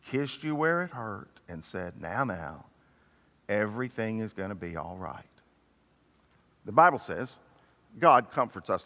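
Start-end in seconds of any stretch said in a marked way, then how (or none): 2.0-5.2 soft, low, soothing murmur; loving reassurance. Acted. Mother comforting a child with a scratched knee, soft and soothing. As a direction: hush a hurt child tenderly. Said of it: "carried you in lovingly".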